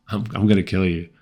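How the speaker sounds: casual, understated threat; offhand, unbothered delivery of a threat; nonchalant, thrown away. Shown as a demonstration. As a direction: toss the line off as if it were nothing. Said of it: "you would just throw that away".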